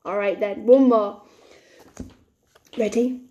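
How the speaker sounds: ordinary talk, nothing marked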